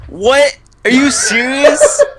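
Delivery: loud, high, incredulous cry; mock outrage. Loser reacting to the winner's apology, hammed-up outrage. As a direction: stretch it out high and loud, appalled.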